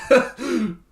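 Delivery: short, high, loud laugh; a quick burst of high laughter; amused laugh. Real, not acted. Streamer joking about an obnoxious card, amused laugh. Jokes that card was a necessity.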